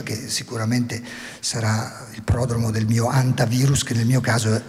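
usual speaking voice

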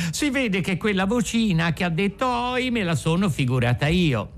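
strained make-believe amusement; forced laughter. A narrator's reading. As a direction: talk yourself out of fear, half laughing. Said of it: "sforzandosi di ridere".

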